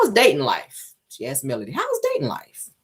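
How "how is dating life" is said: squeaky falsetto take of the question; high put-on voice. Shown as a demonstration. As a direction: pitch it way up, playful. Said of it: "I like to do my impressions".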